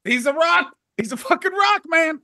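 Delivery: rising frustrated whine; exasperated venting. Retold or imitated. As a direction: throw up your hands, he won't crack. Frustrated warm-up guy venting.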